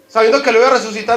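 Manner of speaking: usual speaking voice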